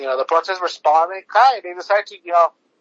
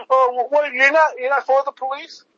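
mock questioner; 6 semitones higher than usual. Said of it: "And people are asking".

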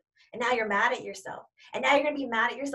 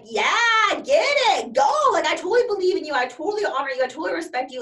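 high, loud, fast cheering; excited cheer; 6 semitones higher, 6 dB louder than usual. Retold or imitated. Friend Bobby cheering you on before the interview, excited. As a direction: hype your friend up, all in. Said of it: "And then Bobby's like".

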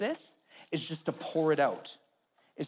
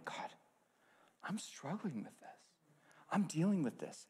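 much softer, confiding struggle to God; quiet prayer; 9 dB quieter than usual. Retold or imitated. Believer quietly confessing struggle to God. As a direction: murmur it to God.

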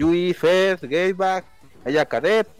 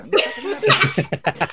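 higher, slow sing-song taunt; drawn-out taunt; 11 semitones higher, much slower than usual. Acted. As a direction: stretch each word to mock them. Superfan repeats, exaggerated.

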